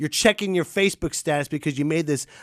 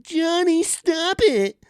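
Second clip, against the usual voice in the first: whiny parent call; 13 semitones higher, slower than usual. Retold or imitated. Mocking a useless parent calling out to Johnny. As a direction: call the kid's name in a helpless, high whine. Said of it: "Don't just yell out".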